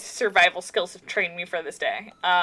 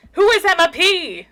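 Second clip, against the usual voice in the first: worried outburst; 8 semitones higher, 7 dB louder than usual. Acted. Panicked dad calling out worried.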